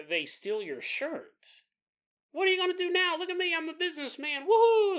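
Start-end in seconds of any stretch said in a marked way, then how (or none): 2.3-5.0 high voice ending in a whooping laugh; gloating thief. Retold or imitated. Shirt thief brags and whoops in a high voice. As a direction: crow over the stolen shirt.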